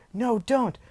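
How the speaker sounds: high-pitched mock alarm; acted panicky plea; alarmed cry. Bystanders react in alarm.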